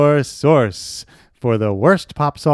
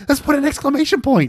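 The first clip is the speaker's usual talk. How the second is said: excited inventor; 9 semitones higher than usual. Retold or imitated. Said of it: "It's like".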